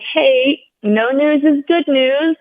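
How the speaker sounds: reassuring. Retold or imitated. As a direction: sound breezy so your husband won't worry. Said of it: "thinking that that would, like, reassure him".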